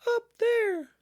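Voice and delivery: very high, loud piglet squeak; alarmed Piglet. A narrator's reading. Piglet waving his paw toward the trap, high alarmed squeak. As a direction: squeal it urgently. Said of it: "said Piglet".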